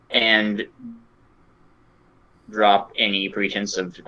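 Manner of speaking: normal talking voice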